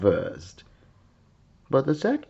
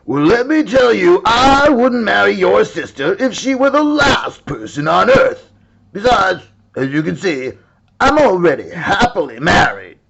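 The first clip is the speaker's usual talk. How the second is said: high, loud huffy bear voice; indignant bear; 8 semitones higher, 7 dB louder than usual. Acted. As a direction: bristle and refuse, loud and high. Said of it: "said the brown bear".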